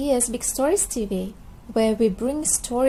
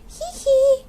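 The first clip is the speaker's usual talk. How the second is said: squeaky little piggy giggle "hee hee"; playful high-pitched giggle; 13 semitones higher, 5 dB louder than usual. Acted. Peppa giggles at George.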